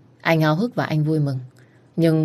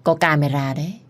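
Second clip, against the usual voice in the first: ordinary delivery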